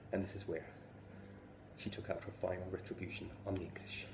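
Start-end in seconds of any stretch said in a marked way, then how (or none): none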